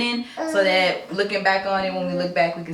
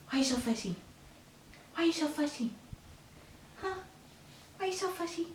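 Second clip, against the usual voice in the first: high, much softer cooing; 8 semitones higher, 15 dB quieter, faster than usual. Real, not acted. Mother cooing softly at baby.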